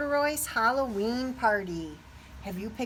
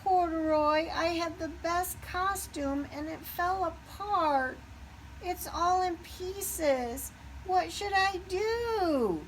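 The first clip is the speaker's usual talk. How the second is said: high, slow, forlorn little voice; a little puppy close to tears; sad Puppy; 8 semitones higher, slower than usual. Acted. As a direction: plead like a heartbroken pup. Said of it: "Puppy looked very sad".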